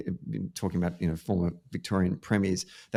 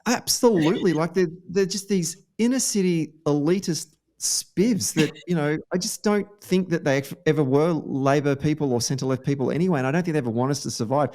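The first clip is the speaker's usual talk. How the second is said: high-pitched snobbish scorn, hammed up; mock snob; 7 semitones higher than usual. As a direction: play an elitist looking down on upstarts. Acting snobbish critics of Labor leaders.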